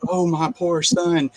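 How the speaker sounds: high, slow mock wail of a grieving mother; imitating a showy mourner at a funeral; fake mourning. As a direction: ham up her fake grief. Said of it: "putting on this big show".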